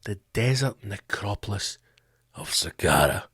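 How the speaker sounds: deep dramatic trailer narrator; Hollywood trailer voice. Shown as a demonstration. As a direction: intone it like a blockbuster trailer. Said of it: "It just sounds Hollywood".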